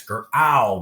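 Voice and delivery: "Growl" as "gr-OW", the vowel punched loud; loud, split "gr ... ow"; middle sound stressed. Shown as a demonstration. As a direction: sound out "Growl" without the final l, hitting ow. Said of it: "what do we hear next?".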